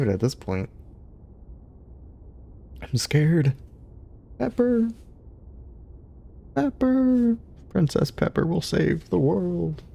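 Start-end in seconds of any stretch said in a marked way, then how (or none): 2.8-3.5 whispered, playfully frightened; mock scared whisper. Streamer pretending to be scared for chat, whispered. Mock fear about world ending. 4.4-9.8 playful calling out. Pretend. Calls and hails her dog.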